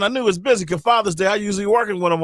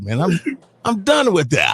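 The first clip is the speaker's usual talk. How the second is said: normal talking voice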